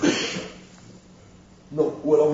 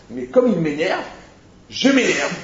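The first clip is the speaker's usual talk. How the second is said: mock irritated; 7 semitones higher, 5 dB louder, slower than usual. Acted self-justifying angry quote.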